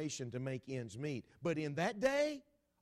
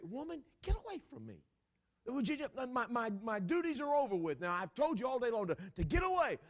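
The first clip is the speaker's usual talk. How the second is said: exasperated judge; 8 semitones higher, 4 dB louder than usual. Retold or imitated. Judge fending her off.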